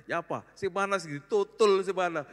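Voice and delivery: bewildered where to tap; confused grandpa. Retold or imitated.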